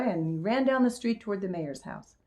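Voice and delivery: plain reading voice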